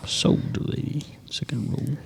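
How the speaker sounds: hushed auctioneer whisper. Pretend. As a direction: whisper it like a secret. Auctioneer bit after "going twice.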